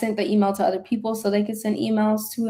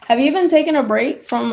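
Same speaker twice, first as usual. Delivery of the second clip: ordinary talk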